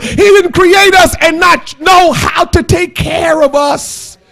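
high, shouted preaching; full-force preaching. Real, not acted. Preacher insists nothing is surprising to God, full-force preaching.

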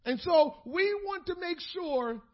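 impassioned pulpit climax; full-force preaching. Acted. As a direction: cry it out to the church in full fervour.

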